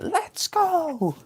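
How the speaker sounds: very high squealed imitation of a scream; mock scream. Commentator imitating the fighter off camera, high-pitched mock scream. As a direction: shriek it high, imitating her war cry. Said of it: "I think she was screaming".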